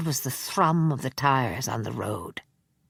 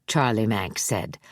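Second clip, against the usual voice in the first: ordinary delivery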